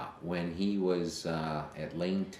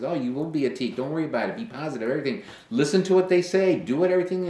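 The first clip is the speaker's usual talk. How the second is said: loud, higher pep-talk; excited encouragement; 10 semitones higher, 10 dB louder, faster than usual. Retold or imitated. Father pumps up his son about pledging, loud and excited. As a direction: cheer him on like an overjoyed dad.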